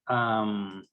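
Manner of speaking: ordinary talk, nothing marked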